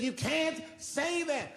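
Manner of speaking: high, slow, whiny plea; hammed-up tearful woman, high and slow; mock crying woman. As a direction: drag it out like a tearful heckler. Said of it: "She says".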